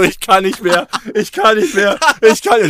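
loud, high, frantic pleading; desperate pleading. Pretend. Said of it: "Und dann einer so".

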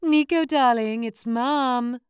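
high, loud sing-song call. Acted. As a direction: call out to the kitchen. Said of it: "she called out to her son".

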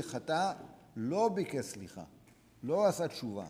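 usual speaking voice

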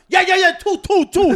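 high, loud pushy yeah yeah two; a greedy kid pressing his demand; eager kid. Retold or imitated.

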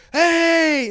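high, loud mock "hey" to a friend; calling out. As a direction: holler it like spotting a buddy on the street. Friend calls out to someone he knows.

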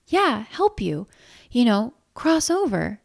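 slow, awkward suggestion; clumsy explanation. Acted. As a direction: fumble toward the delicate phrase. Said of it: "Hema said clumsily".

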